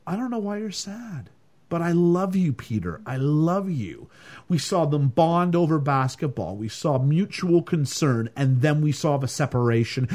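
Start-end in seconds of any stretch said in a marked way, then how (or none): 0.1-4.1 tender dog. Retold or imitated. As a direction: coo it gently like a loving dog.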